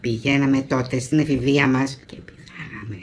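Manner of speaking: soft, slow, low confiding voice; patient slowly confessing his youthful brothel visits; hushed confession. Acted. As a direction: lean in and confess it quietly, slowly.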